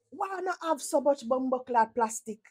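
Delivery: ordinary talk, nothing marked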